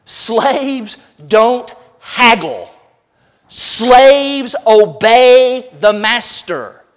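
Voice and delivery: very slow, word-by-word declaration; emphatic repetition. Real, not acted. Preacher restating the rule for slaves, very emphatically. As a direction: stretch each word out and land it firmly. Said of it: "I want to say once again".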